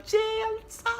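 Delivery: can't contain the excitement; thrilled squeal. Real, not acted. Telling viewers her start date, thrilled squeal.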